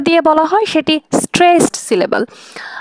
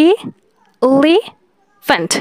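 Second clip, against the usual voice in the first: Elephant said very slowly, each syllable pulled apart; syllables drawn out; much slower than usual. Shown as a demonstration. Teacher to students, counting mouth openings, very slow. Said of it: "এখানে মুখ তিনবার খুলছে".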